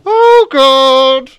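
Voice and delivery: slow "hang on" rising to a loud high "Oh, God"; building to a shocked cry; mock alarm, loud and high at the end; shocked realization. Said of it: "I was like".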